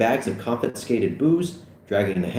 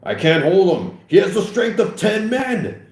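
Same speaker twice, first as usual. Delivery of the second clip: loud, high cry for show; hammed-up panicked shout; straining alarm; 11 semitones higher, 5 dB louder than usual. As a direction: call out as if losing your grip. Officer feigns losing grip.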